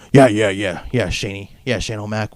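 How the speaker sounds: a hint of a dad placating his son; lightly voiced father brushing off his boy; indulgent daddy. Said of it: "Shane McMahon using his daddy".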